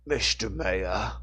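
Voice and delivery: slow, thick Cockney drawl; Cockney accent. A narrator's reading. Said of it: "with a thick Cockney accent".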